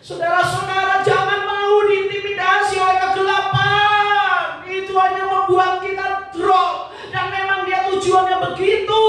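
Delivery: fiery sermon climax, half sung; full-force preaching. Acted. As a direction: preach it out to the whole church.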